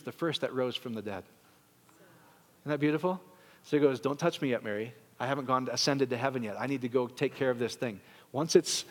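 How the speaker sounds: soft, hushed tender voice; tender Jesus. Acted. Pastor voicing Jesus to Mary, soft and tender. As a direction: speak gently, like a secret.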